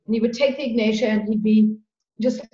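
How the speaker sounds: ordinary delivery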